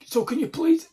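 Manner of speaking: put-on begging; high mock plea. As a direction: whine it like the stranger. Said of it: "then he goes".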